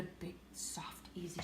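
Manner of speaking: the word soft breathed unvoiced; whisper. A narrator's reading. Reader whispering soft to the child. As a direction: whisper it softly.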